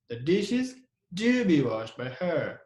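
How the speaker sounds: slow sentence with "do" held and stressed; pause, then lean on "do". Shown as a demonstration. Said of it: "we put also the do as emphatic word".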